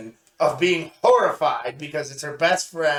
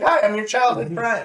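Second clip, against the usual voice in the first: cheery NPC greeting; 6 semitones higher, 4 dB louder than usual. Pretend.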